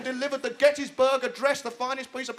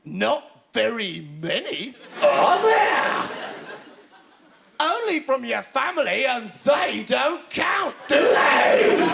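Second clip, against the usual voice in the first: slow, mocking sing-along questions, swooping up high; drawn-out gloating; taunting; 3 semitones higher, 5 dB louder, much slower than usual. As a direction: rub it in with loud, high tag questions. Captain taunting about birthday cards.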